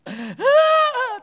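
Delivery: piercingly high, loud mock wail. Widow drowning out her puzzled friends, loud mock wail. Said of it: "Tambah keras anginnya".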